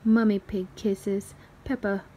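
ordinary delivery, nothing marked

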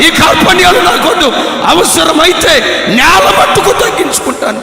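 loud, slowed, fervent declarations; impassioned preaching. Acted. Pastor vowing to drop grudges, preaching at full force. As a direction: build the vow to a ringing climax.

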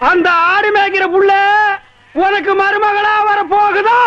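high-pitched, drawn-out yell. Acted. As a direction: holler it slowly across the field. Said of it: "ஏன்டா இந்த கத்து கத்துற".

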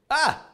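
sudden high yelp of surprise; a quick startled cry; surprised yelp. Real, not acted. Coder caught out by his own code, high yelp.

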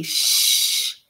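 sharp voiceless shh; hissing shush. Acted. Andrew silencing Marie, hissing shush. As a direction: hush her hard. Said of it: "I hissed".

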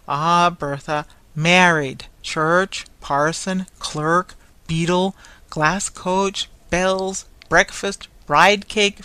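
loud, emphatic rattled-off list; impatient explaining. A narrator's reading. Toy merchant explains a wedding to blind girl, impatient and loud. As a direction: hammer each item out in exasperation.

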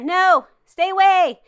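loud, high, drawn-out mock panic; playful panic. Player warns off the chasing teacher, playful panic. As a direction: fend off a chaser, hammed-up.

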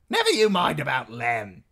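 high, loud dismissal; bossy innkeeper's wife. A narrator's reading. Innkeeper's wife dismissing a guest's joke, high brusque voice.

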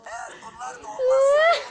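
loud ranting shout. Acted. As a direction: shout the threat. Dubbed YouTuber ranting.

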